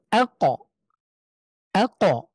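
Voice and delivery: আ, ক with the ক popped into a short extra vowel; qalqalah bounce. Shown as a demonstration. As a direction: bounce off the ক in আ, ক with an echoed release. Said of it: "ধাক্কা দিয়ে পড়ি".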